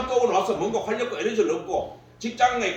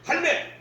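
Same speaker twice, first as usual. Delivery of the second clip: 할매 with a sharp high accent; accented call; 9 semitones higher, much slower than usual. Shown as a demonstration. Lecturer demonstrating the accent on 할매, sharp high call. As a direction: punch 할매 high and short. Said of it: "액센트를 내줘야 돼요".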